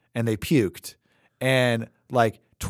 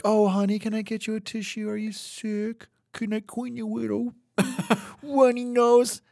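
higher, softer, sugary caretaker voice; doting caring parent; 9 semitones higher, 4 dB quieter than usual. Retold or imitated. Imitating a caring parent soothing a sick kid, higher and softer. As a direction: coo over a sick child like a sweet nurse. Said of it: "most humans are like".

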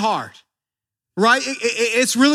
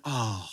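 soft sad sigh; 11 semitones lower, 11 dB quieter than usual. Retold or imitated. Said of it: "we naturally think he went".

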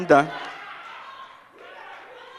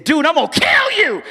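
retold gunman's threat; 7 semitones higher than usual. Preacher retelling the gunman's threat, mock angry yell. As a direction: snap it like the man with the gun.

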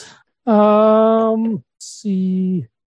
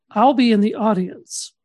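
ordinary talk

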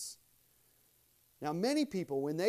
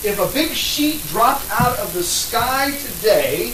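loud, rising sermon oratory; impassioned preaching; 3 semitones higher, 14 dB louder than usual. Real, not acted. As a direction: preach it hard to the room. Preaching at full force after mic.

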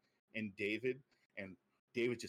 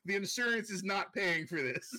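giggling, higher and louder; laughing; 9 semitones higher, 4 dB louder than usual. Real, not acted. Joking to a friend about the wrecked town, laughing. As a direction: joke through the laughter.

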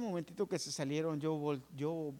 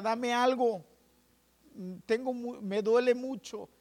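loud, high pleading cry for relief; patient begging the nurse for painkillers, loud and desperate; retold pained yell; 7 semitones higher, 6 dB louder than usual. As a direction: beg the nurse for something, straining. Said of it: "Yo gritaba".

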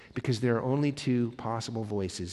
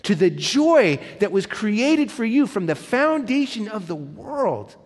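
a hint of a fond father, higher and slow at first; tender welcome; 6 semitones higher, 4 dB louder, slower than usual. God the Father welcoming a beloved child, lightly voiced tenderness. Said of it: "And God the Father would say".

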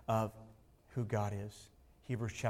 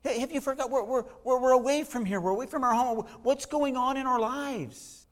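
high, pleading mock lament; a hammed-up wail of forgotten exiles; complaining exiles; 12 semitones higher, 6 dB louder than usual. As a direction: voice the people crying out to God, pitched up and loud. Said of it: "The people are going".

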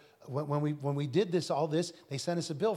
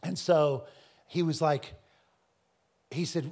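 usual speaking voice

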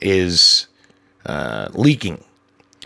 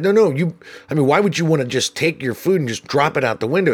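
mock helpful; 6 semitones higher, faster than usual. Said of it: "trying to be as helpful as possible".

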